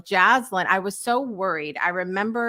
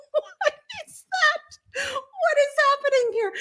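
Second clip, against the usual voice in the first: laughing. Retold or imitated. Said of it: "And I'm like".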